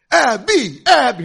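loud, high call of her name. Retold or imitated. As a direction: shout her name to get her attention. Kids calling out to the girl.

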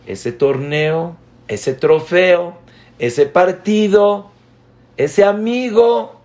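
building excitement. Acted. List builds to child's big feelings.

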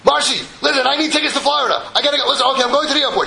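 high, loud, rushed orders; frantic businessman. Retold or imitated. Businessman frantically tells his secretary, high and loud. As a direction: bark it out high like a man in a panic.

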